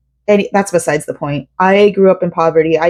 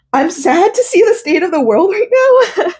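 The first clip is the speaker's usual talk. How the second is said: much higher, voice breaking with tears; tearful; 11 semitones higher than usual. Real, not acted. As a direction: fight back real tears as you say it. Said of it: "I'm so sorry for getting so emotional".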